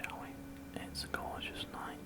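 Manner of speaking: ordinary talk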